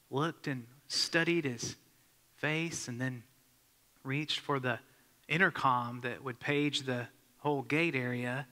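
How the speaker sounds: ordinary delivery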